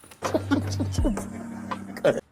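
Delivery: silent wheezing laugh; can't stop laughing, no voice; breathless laugh. Real, not acted. She cracks up at her own story, with a wheezing laugh.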